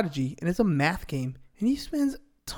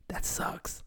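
whisper; 12 dB quieter than usual. Real, not acted. Aside whispered to listeners.

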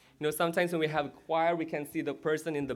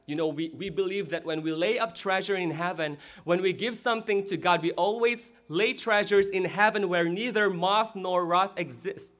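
ordinary delivery

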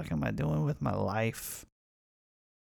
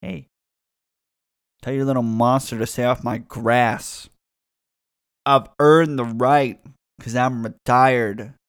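scolding neighbor; 11 dB louder than usual. Retold or imitated. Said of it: "Knock on their parents' house door".

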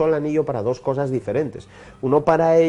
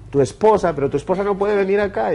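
retold objection, higher and quicker; protesting boss; 7 semitones higher than usual. Boss objecting that the wife could come here, incredulous. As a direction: object in lightly acted disbelief, pitch lifted.

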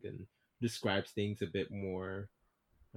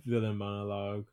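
usual speaking voice

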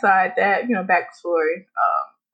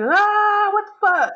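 mock yell; 12 semitones higher, slower than usual. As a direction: wail it high like the yelling neighbor. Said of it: "why are you yelling".

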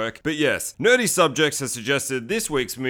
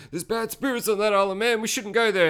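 high-pitched mock warning; superstitious character; 8 semitones higher than usual. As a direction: warn them off in a high, superstitious voice.

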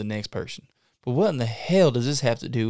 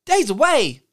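much higher, louder panicked squeal; shrieked alarm; 9 semitones higher, 7 dB louder than usual. Acted. Hearing the podcast is broke, high shocked shriek. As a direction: echo the bad news in shock.

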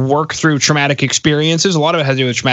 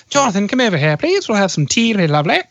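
higher-pitched mock British voice; British accent; 6 semitones higher than usual. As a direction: play a twee British host inviting to tea. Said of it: "I can say that as a Brit".

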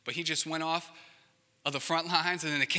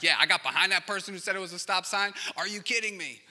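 higher, louder, fast indignant rant; exasperated driver; 5 semitones higher, 6 dB louder than usual. Retold or imitated. Driver stuck behind a cautious car, fast indignant complaint. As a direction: huff at the driver ahead like you can't believe it. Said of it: "And others of you are like".

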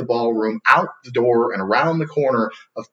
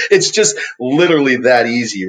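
louder, through a laugh; 2 semitones higher, 6 dB louder than usual. Real, not acted. Speaker amused by his sales book pitch, laughing. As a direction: say it as you chuckle at your own bold claim.

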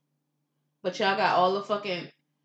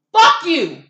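a full-throated yell, higher and much louder; furious shout; 8 semitones higher, 16 dB louder than usual. Real, not acted. Woman yelling at people who want her silent, furious shout. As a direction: scream it at everyone who told you to shut up.

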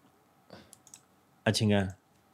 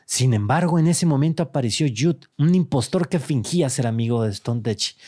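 dramatic storytelling read; story narration. Acted. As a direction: narrate it like a dark tale. Reads AI story aloud.

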